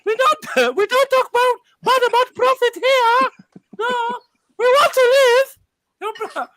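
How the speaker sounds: high, loud frightened voice; nervous high-pitched pleading; scared voice. Pretend. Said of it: "Why do you sound scared?".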